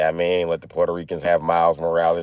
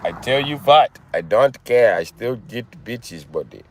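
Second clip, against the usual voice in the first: put-on Indian accent. Streamer joking about his hairline to viewers, Indian accent. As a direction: brag in a comic Indian accent. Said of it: "Say it with an Indian accent".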